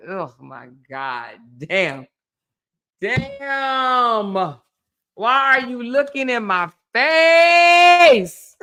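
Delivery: mock disgust building to a high, loud, slow outburst; disgusted reaction. Acting out waking beside a husband with morning breath, mock disgusted. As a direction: recoil from a partner's morning breath.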